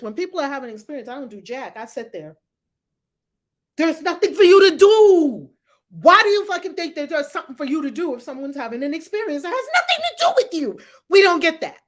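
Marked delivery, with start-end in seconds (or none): 3.8-11.8 loud, high-rising exasperated rant; exasperated scolding. Real, not acted. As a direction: tell them off, voice climbing high. Scolding would-be helpers, exasperated.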